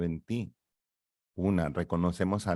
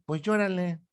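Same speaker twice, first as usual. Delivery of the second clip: much higher, teasing "llórale"; high coaxing ego; 10 semitones higher than usual. Acted. Ego telling the baby to cry, much higher teasing voice.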